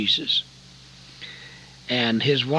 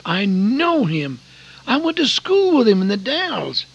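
excited recognition; 10 semitones higher, 5 dB louder than usual. Retold or imitated. Telling his wife he recognizes someone.

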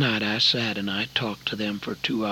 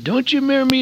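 higher, louder hail across the camp; calling out; 13 semitones higher, 6 dB louder, much faster than usual. Retold or imitated. Hailing an old schoolmate across the campground, calling out. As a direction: call out eagerly to an old friend.

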